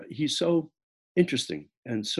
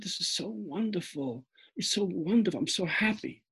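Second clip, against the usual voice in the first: gushing delight; 6 semitones higher, 5 dB quieter than usual. Retold or imitated. Narrator recalls praising his professor's novel, high hushed gushing. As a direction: gush over the gift, hushed and thrilled.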